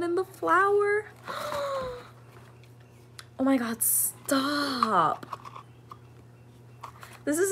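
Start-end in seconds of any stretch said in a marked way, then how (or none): 1.3-5.3 high gasp, then a slow oh my God, stop; overwhelmed by the gift; real breathless awe. Real, not acted. Overwhelmed by the earrings.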